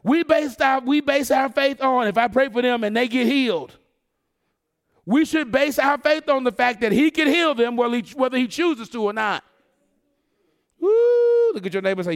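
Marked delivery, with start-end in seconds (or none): 0.0-9.4 impassioned, raised sermon delivery; full-force preaching. Real, not acted. Preacher urging the congregation about the fiery furnace, full-force preaching. 10.8-11.5 loud, high, sustained "woo"; excited whoop. Real, not acted. Preacher worked up by his own point, excited whoop.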